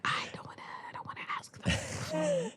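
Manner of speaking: unvoiced, scared little whisper. Refusing Miles, whispering so the room won't hear. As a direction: whisper it like you're hiding. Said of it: "I'm like".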